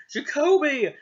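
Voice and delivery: high loud call of alarm; alarmed cry. Acted. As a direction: call his name in panic. Friend coughing blood, alarm.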